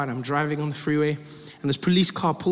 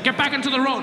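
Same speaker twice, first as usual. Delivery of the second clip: irritated cop; 6 semitones higher than usual. Retold or imitated. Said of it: "He's like".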